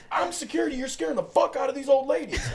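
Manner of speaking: high, loud, incredulous outburst; retold shout at a troublemaker, edged with laughter; hammed-up outraged scolding; exasperated yelling. Retold security guard scolding Wayne.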